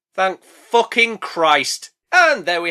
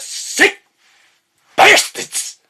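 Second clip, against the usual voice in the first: rasping shout, louder; mock insult yell; 13 semitones higher, 3 dB louder, much slower than usual. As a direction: spit the word at the crows. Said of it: "I love doing the bastards".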